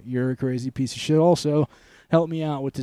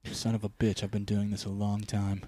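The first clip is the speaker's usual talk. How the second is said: low Batman voice; 5 semitones lower, 6 dB quieter than usual. Retold or imitated. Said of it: "I'm gonna take my".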